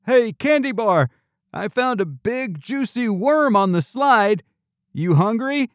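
put-on high-pitched kid's taunt; high, playful needling; teasing. A narrator's reading. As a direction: tease a friend, pitched well up. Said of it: "Spud teased".

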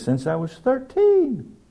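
timid, high, drawn-out reply; high slow answer. Retold or imitated. Guest answers the scoffing pilot, high and slow.

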